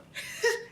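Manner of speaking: short breathy laugh. Real, not acted. Laughs at story.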